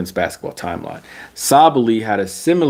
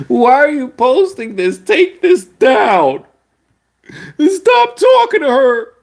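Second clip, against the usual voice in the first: much higher, louder whiny mock fan, ending in a fake cry; mocking upset fans; 4 dB louder than usual. Vlogger mocking crying W fans in the comments, high whiny fake sob. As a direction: whine like a wounded superfan, slowing on take this down. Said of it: "W fans in the comment like".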